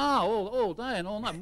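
ordinary delivery

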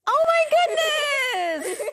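high, loud, choked-up gasp; real tears breaking through surprise; tearful gasp. Real, not acted. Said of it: "Oh, I'm gonna cry".

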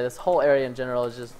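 usual speaking voice

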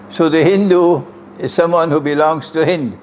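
ordinary talk, nothing marked

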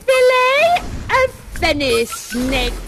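high, slow theatrical witch; second witch. Acted. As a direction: call out the ingredient as a grand incantation. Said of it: "now the second witch".

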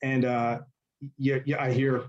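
ordinary talk, nothing marked

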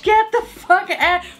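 drawn-out high outrage at the price; outraged disbelief. Real, not acted. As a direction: stretch it out in disgust. Outraged dismissing overpriced product.

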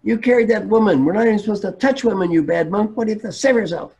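high-pitched, indignant scolding; angry monk. Retold or imitated. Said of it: "his colleague is still angry".

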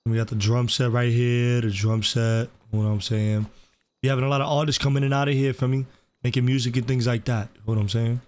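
smooth hustler character. Acted. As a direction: play a smooth-talking record label boss. Plays RP character Tay Cardi.

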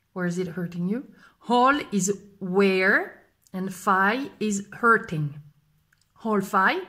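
ordinary talk

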